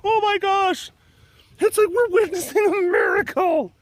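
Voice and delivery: high, loud, amazed gushing; awestruck fan. Retold or imitated. Pride members gasping as the cat rubs the fence, awestruck. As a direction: gush like you're witnessing a miracle. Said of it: "they were like".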